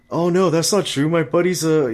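high, loud hyping friend imitation; mock eager buddy. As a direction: ham up the friend bragging for him. Mocking buddy hyping him.

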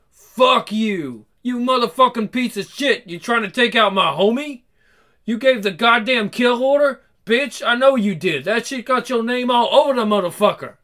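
acted street-tough outrage; angry confrontation. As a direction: tear into him, loud and insistent. Acted angry confrontation over a friend.

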